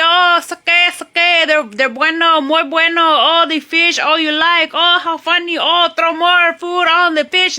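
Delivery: high, loud voice of a delighted local man; excited local. Retold or imitated. Local man showing off his fish to a tourist, excited. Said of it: "And they go".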